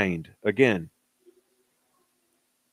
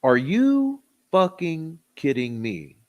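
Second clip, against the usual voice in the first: slow, higher-pitched disbelief; incredulous; 4 semitones higher, slower than usual. Real, not acted. Commentator scoffing at claim he was not under stress, incredulous. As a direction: drag it out in exasperated disbelief.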